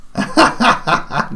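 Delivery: high rattling laugh; quick bubbling laugh; amused laugh. Real, not acted. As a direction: break into high giggles. Amused at the skeleton.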